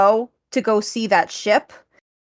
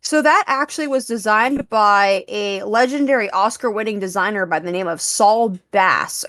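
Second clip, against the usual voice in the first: ordinary talk, nothing marked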